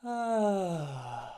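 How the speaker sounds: a loud open sigh, up high; big sigh. Shown as a demonstration. Instructor showing the class a big relieved sigh. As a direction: let it all out in one big sigh. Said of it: "out with a big sigh".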